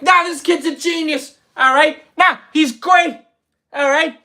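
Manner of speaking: high, loud, punchy comic rant; Bill Burr. Acted. Comic quoting Bill Burr's praise of his impression, high excited rant. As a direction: crow it in high, emphatic bursts. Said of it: "he's like".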